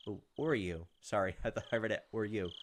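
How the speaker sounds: usual speaking voice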